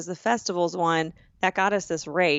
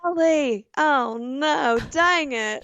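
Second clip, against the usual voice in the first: high mock Southern dismay; playing dumb in a Southern accent to her friend; 6 semitones higher, much slower than usual. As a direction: ham up Southern aw-shucks. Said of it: "Southern accent".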